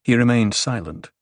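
usual speaking voice

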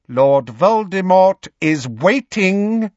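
high, cold, loud and slow menace; imperious dark lord, drawing the words out; Voldemort. A narrator's reading. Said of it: "heard the high, cold voice say".

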